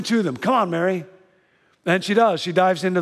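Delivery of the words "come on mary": calling out. Acted. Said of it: "everybody in the boat yells".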